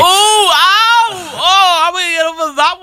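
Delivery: high hammed-up yelps of hurt; mock wounded cry. Teased about radio skills, hammed-up pained crying.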